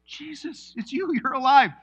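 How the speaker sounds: high, loud, overjoyed cry of recognition; can't believe he's alive; amazed recognition. Acted. Disciples recognizing Jesus at the table, overjoyed.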